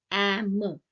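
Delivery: "Am" loud, vowel first, then a released M; sounded-out syllable. Shown as a demonstration. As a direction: stress both sounds of "Am" for learners. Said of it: "A is the onset".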